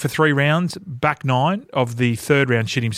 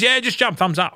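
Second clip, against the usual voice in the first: casual crew guy; 9 semitones higher than usual. Retold or imitated. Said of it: "The guy goes".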